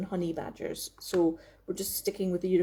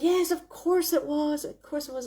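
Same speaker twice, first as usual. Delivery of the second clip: mock sudden recall; 6 semitones higher than usual. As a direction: act out remembering the obvious. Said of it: "and I'll be like".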